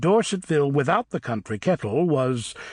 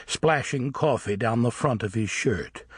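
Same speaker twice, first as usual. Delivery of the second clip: ordinary delivery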